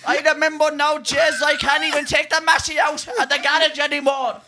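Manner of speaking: ranting in a Kerry brogue about a chip shop order; Healy-Rae impression. Imagining the Healy-Raes queuing in Roberto's, loud Kerry accent. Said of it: "what if they're down in Roberto's later".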